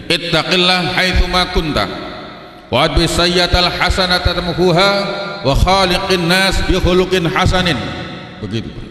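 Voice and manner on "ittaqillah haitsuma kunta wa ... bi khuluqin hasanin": higher, chanted Arabic sermon recitation; khutbah tone. Shown as a demonstration. Preacher showing the congregation the khutbah tone again, higher and chanted. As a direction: recite it as if from the mimbar. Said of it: "kalau khutbah itu kira-kira nadanya begitu".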